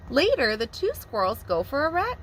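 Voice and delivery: usual speaking voice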